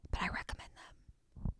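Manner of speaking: very soft, voiceless whisper; whispered aside. Real, not acted. Hushed aside after heartbreaking books.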